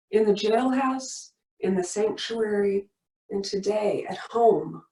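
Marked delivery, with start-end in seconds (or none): none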